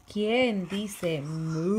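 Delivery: put-on loud, slow, stretched question for the baby; reading to baby. Shown as a demonstration. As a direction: draw the question out big and slow. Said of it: "reading to baby".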